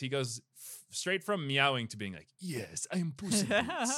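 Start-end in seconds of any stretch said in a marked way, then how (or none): 2.4-4.0 smooth adult suave cat hero, pitched up high; Antonio Banderas voice. Retold or imitated. To the hosts, doing the cat's adult movie voice, suave. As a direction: play the grown-up cat hero announcing himself. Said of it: "He only ever has the voice of adult Antonio Banderas".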